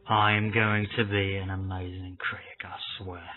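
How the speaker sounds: hushed, low whisper to yourself; whispered aside. Acted. Critic whispers to himself about his career, hushed aside. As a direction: whisper it, dying away at the end.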